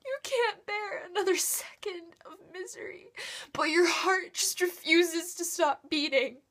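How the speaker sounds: high, crying, increasingly loud despair; anguished, tearful protest. Acted. Anguished monologue on unbearable misery.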